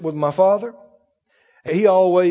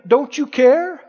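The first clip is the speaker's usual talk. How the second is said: mild pleading; 6 semitones higher, 3 dB louder, slower than usual. Retold or imitated. Disciples crying out to Jesus.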